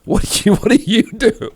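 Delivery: real laughter breaking through the question; laughing. Real, not acted. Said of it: "I'm laughing right now".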